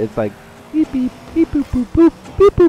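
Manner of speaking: high rising beep-boop noises; imitated car beeps. Gamer mocking his car's sound, high playful beeps. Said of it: "my car, every time I hit it".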